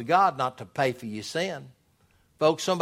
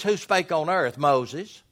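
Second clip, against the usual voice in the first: ordinary talk, nothing marked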